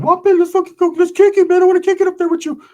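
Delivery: high, eager falsetto; high-pitched keen sidekick impression; Ricky. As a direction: pester excitedly in a squeaky voice. Imitates Ricky eager to hang.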